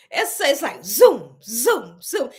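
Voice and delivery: slow zoom, zoom, zoom like the MRI machine; imitating machine zoom. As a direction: mimic the machine's zooming. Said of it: "That zooming whoop noise".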